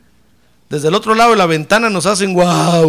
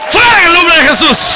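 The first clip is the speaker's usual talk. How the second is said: even louder, higher repeat of the rebuke; rebuking shout; 8 semitones higher, 7 dB louder than usual. Acted. Preacher repeats the rebuke to the congregation, shouting harder. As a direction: drive it out, full voice.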